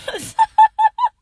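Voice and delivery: sudden high squealing laugh; burst of laughter. Real, not acted. Podcaster forgets her point and bursts out laughing. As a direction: crack up loudly, caught off guard. Cracks up mid-sentence.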